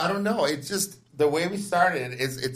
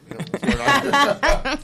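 high, loud and slow laughing; laughing tease; 12 semitones higher, 7 dB louder, slower than usual. Pretend. Teasing guests' energy.

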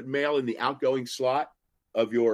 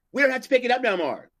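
much higher, louder mock carrier; mail carrier voice; 13 semitones higher, 7 dB louder than usual. Teller mimics carrier refusing mail, high and loud. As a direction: ham up the careless mail carrier.

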